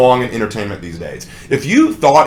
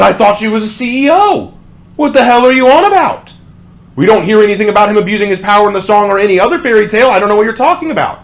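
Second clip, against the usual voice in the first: exasperated; 5 semitones higher, faster than usual. Real, not acted. Exasperated at lyric.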